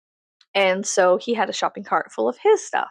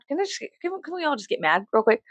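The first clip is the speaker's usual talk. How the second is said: ordinary talk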